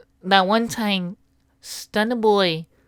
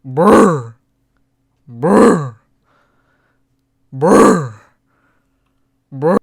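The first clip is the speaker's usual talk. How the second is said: lip buzz noise; 3 semitones lower, 8 dB louder, much slower than usual. Acted.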